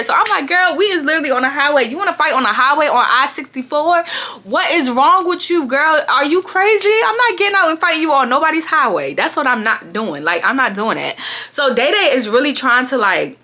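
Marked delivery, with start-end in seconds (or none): 0.5-9.1 higher-pitched, exasperated refusal; incredulous. Retold or imitated. Telling the friend no fighting on the highway, incredulous. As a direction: question her sanity in a raised pitch.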